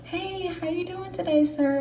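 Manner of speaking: high, sugary greeting; butter up the bus driver; sweet to the driver. Retold or imitated. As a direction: drill instructor's fake-sweet hello to the driver. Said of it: "was so nice to the driver".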